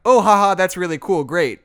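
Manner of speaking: imitating polite, clueless enthusiasm; feigned enthusiasm. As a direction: play along as if impressed. Retells playing along without context.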